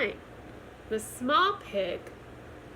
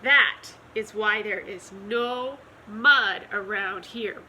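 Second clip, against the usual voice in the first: plain reading voice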